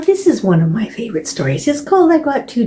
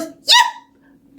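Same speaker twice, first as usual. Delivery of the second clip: very high, sharp little yap; high puppy yip; small dog yip. Retold or imitated. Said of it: "Fanny goes".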